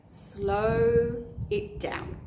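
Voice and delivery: very slow, drawn-out words. Shown as a demonstration. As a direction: stretch each word out slowly. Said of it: "Tip three is slow it down".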